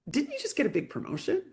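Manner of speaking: high, surprised disbelief; incredulous question. Retold or imitated. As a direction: ask it as if you can't believe her doubt. Coach questioning a doubting client.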